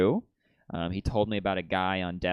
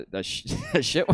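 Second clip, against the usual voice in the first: ordinary talk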